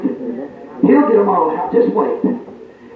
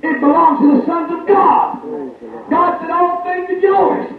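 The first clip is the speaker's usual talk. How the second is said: preaching at full force; 5 semitones higher than usual. Acted.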